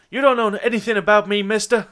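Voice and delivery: acted angry challenge; defiant confrontation. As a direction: stand up to him, loudly. Cornered woman confronts detective with gun.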